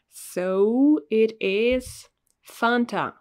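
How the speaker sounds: So it is Fanta drawn out slowly; slow, teasing reveal of Fanta. Shown as a demonstration. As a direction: stretch it out like a reveal. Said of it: "we say Fanta".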